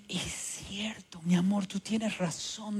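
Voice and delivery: slow, soft whisper. Retold or imitated. Mother quietly admitting her son is right, whispered. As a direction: concede it gently under the breath.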